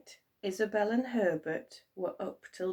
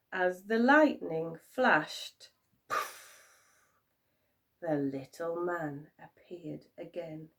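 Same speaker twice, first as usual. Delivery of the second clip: usual speaking voice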